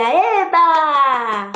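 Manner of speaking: long-drawn happy cheer; gleeful whoop; joy. Shown as a demonstration. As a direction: cheer it out, stretching the last vowel. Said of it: "Posso mostrar minha alegria".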